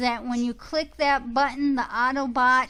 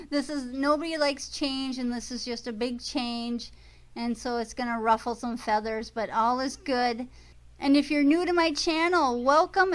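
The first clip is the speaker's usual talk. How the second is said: ordinary delivery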